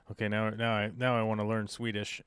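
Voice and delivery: normal talking voice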